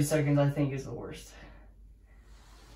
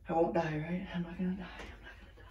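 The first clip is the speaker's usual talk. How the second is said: softer and softer, to a whisper; fading nervous whisper; 13 dB quieter, faster than usual. Real, not acted. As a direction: talk yourself down to a whisper. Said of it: "quieter repeat, fading".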